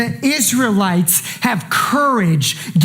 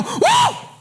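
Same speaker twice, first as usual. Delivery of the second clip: a high, stunned "Whoa!"; wide-eyed high cry of wonder; amazed whoa. Acted. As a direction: gasp it out in sudden amazement. Seeing sin dead on cross.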